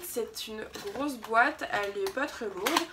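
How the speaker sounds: usual speaking voice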